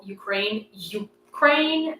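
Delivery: "Ukraine" broken in two, the "U" punched apart from "kraine"; stress on wrong syllable. Shown as a demonstration. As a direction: exaggerate a misplaced stress in "Ukraine". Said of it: "it's just a stress on the wrong syllable".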